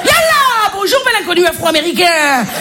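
exaggerated Constantine Algerian accent, leaping high. Porn actress greeting the repairman at the door, Constantine accent. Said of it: "avec l'accent de Constantine".

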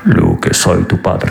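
low, slow Spanish-dubbed Vader; Spanish Darth Vader. Pretend. Darth Vader dubbed in Spanish to Luke, low and slow. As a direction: rumble it like a dubbed villain. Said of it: "Dark Vador s'exprime dans la langue du reggaeton".

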